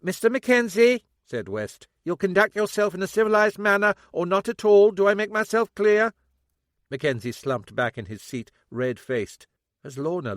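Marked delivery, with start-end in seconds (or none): 0.0-6.1 loud, high stern rebuke; officer rebuking an unruly father; stern female sergeant. A narrator's reading. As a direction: lay down the law firmly.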